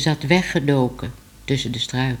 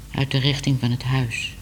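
ordinary delivery

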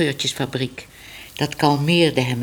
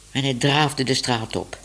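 plain reading voice

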